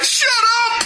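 very high, drawn-out cry of protest; exasperated mock shout. Reader heckling the chatty villain, exasperated mock shout. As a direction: cut him off in hammed-up exasperation.

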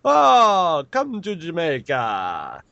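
put-on high, slow Jamaican accent; exaggerated mock Jamaican invite; Jamaican ad guy. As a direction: sing out the Jamaican welcome. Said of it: "I was just like".

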